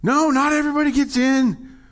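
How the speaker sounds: high, loud mock alarm; alarmed protest. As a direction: protest as if startled by the verse. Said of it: "I read that and I was like".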